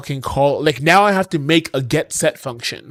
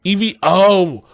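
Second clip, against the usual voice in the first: louder and higher, fed-up disbelief; exasperated outburst; 5 semitones higher, 5 dB louder than usual. Real, not acted. Streamer shouting at the code in exasperated disbelief. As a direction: blurt it out, can't believe it. Streamer finds the missing yields.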